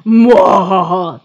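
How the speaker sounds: loud mock villain cackle; evil villain cackle. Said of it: "That's what I imagine happens in the writers' room".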